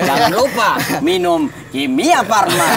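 hammed-up, loud, high sponsor plug; mock advert pitch. Host spoofing a sponsor plug for his crew, hammed-up advert pitch. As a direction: stretch it out like a joke ad, amused. Joke ad inserted into sign-off.

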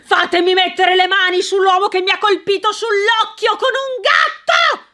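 furious outburst, voice climbing high; raging shout. Acted. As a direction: rage at the unseen culprit. Sir Mortimer raging in garden.

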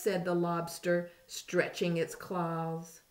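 ordinary delivery

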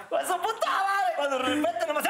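words squeezed out between laughs; laughing through it. Real, not acted. Telling the climax of his joke, laughing hard.

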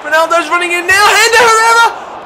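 rising to a high, loud yell, fast; excited commentary. Real, not acted. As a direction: call the attack as it builds, breathless. Live goal chance commentary.

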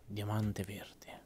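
slow whisper to himself. Real, not acted. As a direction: whisper it under your breath.

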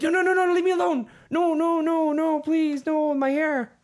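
high, loud, whiny begging; whimpering high plea. Retold or imitated. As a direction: squeal it out like a scared victim. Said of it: "as soon as Nick Cage just, like".

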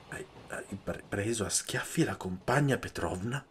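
hushed, tripping start, then the question; stammering. A narrator's reading. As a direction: stumble over the words, stunned. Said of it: "balbettò alla fine".

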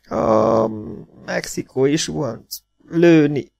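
high, halting injured voice; groaning. A narrator's reading. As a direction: groan out broken words with pauses. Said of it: "nyögte a félvér".

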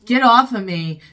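loud, sharp burst of annoyance; angry. Real, not acted. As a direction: shove them away with your voice. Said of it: "I'm getting very angry".

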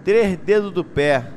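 normal talking voice

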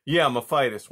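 loud, falling, matter-of-fact confirmation; defiant son. Acted. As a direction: push back loudly at your mother. Son insists against startled mother.

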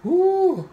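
squealed ooh; high ooh. Real, not acted. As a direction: yelp an ooh. Reacting to heat.

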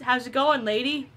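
ordinary delivery

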